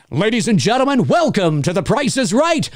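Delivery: big booming announcer, stretched come on down; game show announcer. Retold or imitated. Voice actor on stage belting the announcer call. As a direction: announce it like The Price is Right. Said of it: "lead-in line to get into character".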